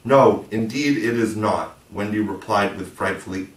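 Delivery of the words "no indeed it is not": slow, every word leaned on; a prim, indignant wife insisting firmly; frightful emphasis. A narrator's reading. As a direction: stamp down hard on each word, no and not. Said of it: "frightful emphasis".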